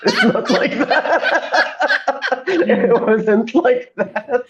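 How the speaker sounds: high laughing, slowed way down; laughing through the words as she retells it to friends. Real, not acted. Friend retells sneezing story, laughing hard.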